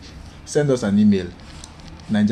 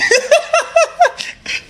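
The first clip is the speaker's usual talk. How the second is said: high laugh. Real, not acted. Host laughs at the joke.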